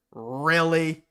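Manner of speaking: loud, drawn-out disbelief; slow, emphatic incredulity; incredulous. Real, not acted. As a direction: stretch each word out in scornful disbelief. Scoffing at the fake outrage.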